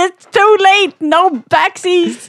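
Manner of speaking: high, loud mock-stern voice; character voice. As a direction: refuse in a put-on character voice. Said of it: "And he says".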